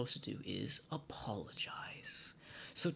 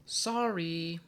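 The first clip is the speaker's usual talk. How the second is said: casual sorry; 6 semitones higher, 5 dB louder than usual. Shown as a demonstration. Casual English sorry example.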